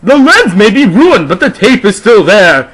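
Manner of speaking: high loud gloating voice with a laugh; hammed-up gloating; triumphant. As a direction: crow over the victory. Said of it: "held up the camera triumphantly".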